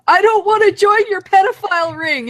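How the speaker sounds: loud high yell at strangers. Retold or imitated. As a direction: shout it across the street. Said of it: "he yelled at them".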